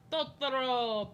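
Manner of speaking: slow, drawn-out "Totoro" in a foreign accent; weird non-native accent. Shown as a demonstration. Streamer showing how they say it, slow foreign-accented "Totoro". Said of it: "unless it's with a weird accent".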